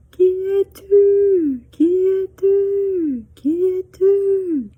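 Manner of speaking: high repeated call, slow, fading away; echo. Acted. Mountains echoing back the girl's question. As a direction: let it return from far off. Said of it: "echo of her call".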